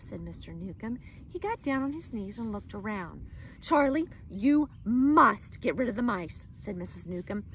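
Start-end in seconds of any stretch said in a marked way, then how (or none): 3.6-6.5 loud demand. Acted. Upset wife demands.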